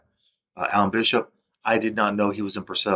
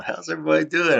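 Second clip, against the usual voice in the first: much higher, a bit louder sing-song hello; smiling greeting; 9 semitones higher, 4 dB louder than usual. Retold or imitated. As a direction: play the boss grinning as he walks in. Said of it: "I remember he was smiling".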